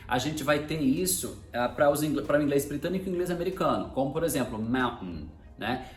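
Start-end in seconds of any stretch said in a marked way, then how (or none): 4.6-5.3 "mountain" with the T as a catch, moun'n; low "mountain", air stopped before the final n; glottal stop. Shown as a demonstration. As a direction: swallow the T in "mountain". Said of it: "quando o glottal stop acontece no meio".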